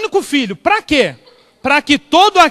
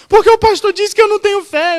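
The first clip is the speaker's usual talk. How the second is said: put-on tearful old lady; 8 semitones higher, 4 dB louder, faster than usual. Crying woman explains her tears.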